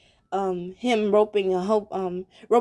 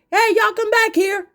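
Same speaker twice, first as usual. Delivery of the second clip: calling out; 11 semitones higher, 5 dB louder than usual. Retold or imitated. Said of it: "they're like".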